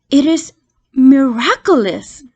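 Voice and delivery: ordinary delivery, nothing marked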